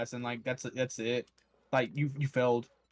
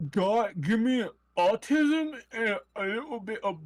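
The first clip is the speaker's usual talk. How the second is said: imitating Eli; 7 semitones higher, slower than usual. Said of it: "he said".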